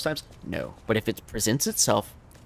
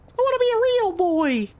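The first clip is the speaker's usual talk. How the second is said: very high, loud mock cry; real boy wannabe; 5 dB louder than usual. Streamer answers as the one who is not a real boy, high pleading squeal. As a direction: squeal it out in a put-on little voice. Acting out the real boy's wish.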